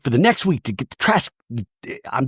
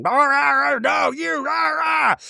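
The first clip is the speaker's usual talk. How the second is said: high shrill rah rah gibberish; imitating indiscernible arguing; mock shouting noise; 12 semitones higher, slower than usual. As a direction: squawk like women arguing. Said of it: "a bunch of noise of them shouting".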